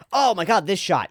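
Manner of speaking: high-pitched excited reaction; thrilled. Real, not acted. Streamer thrilled by a favourite shot, excited. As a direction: burst out in high-pitched delight.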